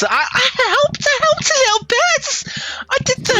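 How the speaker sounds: very high-pitched little character voice; Goyle. Acted. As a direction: squeak it out in a tiny, high voice. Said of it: "Goyle says".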